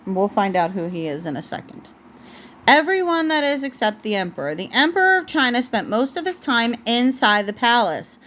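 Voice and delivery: plain reading voice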